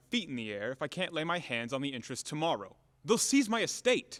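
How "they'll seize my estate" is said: slow, louder and higher, pressing hard; a creditor at the end of his rope; angry. Acted. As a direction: lean on each word with rising anger. Creditor demanding payment.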